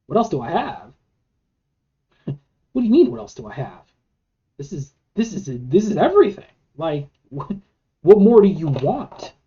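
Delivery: mock-offended, scoffing with laughter; scoffing disbelief. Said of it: "you scoff a little bit".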